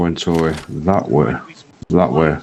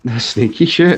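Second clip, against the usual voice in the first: excited; 6 semitones higher, 3 dB louder than usual. Real, not acted. Surprised at sneaky enemy.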